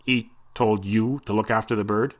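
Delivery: a lightly voiced doubting Mister Smithy; suspicious sailor. A narrator's reading. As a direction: question him with mild suspicion. Suspicious thin man.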